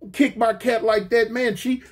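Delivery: ordinary talk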